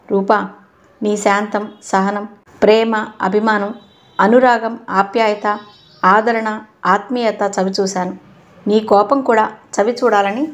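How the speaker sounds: usual speaking voice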